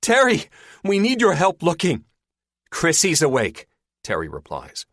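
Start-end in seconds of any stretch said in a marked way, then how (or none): 0.0-2.0 high, loud call for help; yell. A narrator's reading. Searcher calling from deck into the cabin, yelling. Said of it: "Linda yells down". 2.7-3.7 raised, loud reply; calling back. A narrator's reading. Injured woman answering searcher on deck, calling back.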